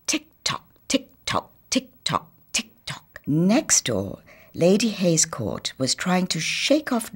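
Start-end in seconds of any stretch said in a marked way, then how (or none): 0.1-3.2 high, whispered tick-tock; ticking clock. Acted. Professor's stopped clock restarting, whispered ticking.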